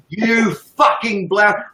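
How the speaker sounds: slow, drawn-out jeer; slow imitated sneer; mock rant quote. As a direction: drag it out like the original ranter. Quoting another streamer's rant.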